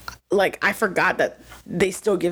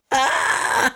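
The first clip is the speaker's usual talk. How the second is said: anguished cry; 6 semitones higher than usual. Real, not acted. Emotional outburst over scene.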